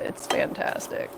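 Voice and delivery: whispered aside. Real, not acted. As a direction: breathe it as a secret. Confiding about the latte.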